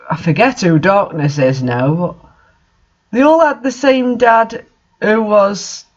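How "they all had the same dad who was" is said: much higher, rising to an incredulous outburst; what on earth, building to a baffled cry; real disbelief. Real, not acted. Reacting to absurd fanfic plot.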